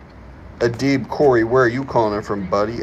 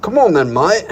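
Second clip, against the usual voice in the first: loud, high mock British "Come on then, mate"; mock British accent; 9 semitones higher, 8 dB louder than usual. Streamer replays his line in a mock British accent. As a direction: bark it like a cheeky Brit. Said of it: "what's up with the accent?".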